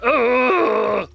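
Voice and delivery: a high, loud mock grunt of effort. Pressing a pillow on his partner, a loud mock grunt.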